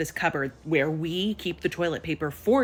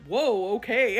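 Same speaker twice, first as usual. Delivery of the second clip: taken-aback dad; 5 semitones higher, 4 dB louder, slower than usual. Retold or imitated. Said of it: "he's like".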